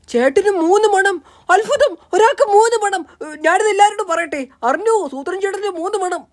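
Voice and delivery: high-pitched, gleeful-sounding proclamation; excited announcing. Acted. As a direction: cry the news out to everyone around. Announces the wonder to everyone. (ആവേശത്തോടെ വിളിച്ചുപറഞ്ഞ്)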